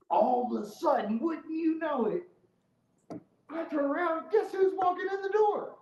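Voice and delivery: high, loud storyteller build-up; incredulous high-pitched teasing of the reveal; suspenseful reveal. Real, not acted. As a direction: build to the punchline, pitch climbing.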